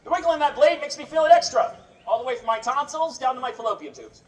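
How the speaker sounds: ordinary talk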